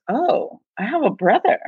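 mock pastor, high and perky; pastor offering brother. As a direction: pitch the offer brightly. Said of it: "And she laughs and she goes".

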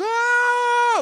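high, loud shriek of laughter; loud mock howl of laughter; imitated family shriek. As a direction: shriek like the whole family cracking up.

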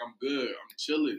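soft, unbothered reassurance; calm. Retold or imitated. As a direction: play it unfazed, voice down. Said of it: "why are you so calm?".

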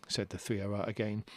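plain reading voice

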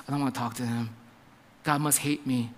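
low, soft mock dejection; dejected. Believer feeling God must hate them, dejected. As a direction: sink low and quiet, imitating someone ashamed.

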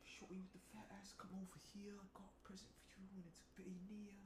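breathy menacing whisper; creepy whisper. Pretend. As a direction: hiss it like a stalker. Creepy whispered game taunt.

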